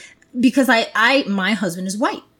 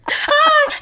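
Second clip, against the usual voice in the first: high loud mock wail; hammed-up mourner's cry; 6 dB louder than usual. Mourner at a funeral, hammed-up wail. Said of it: "people are like".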